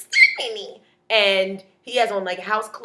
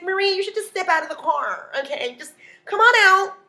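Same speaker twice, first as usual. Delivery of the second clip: higher mock girlfriend voice; coax, building to a loud high call; imitated pushy plea; 10 semitones higher, 5 dB louder than usual. Girlfriend coaxes from outside.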